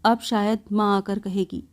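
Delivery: ordinary delivery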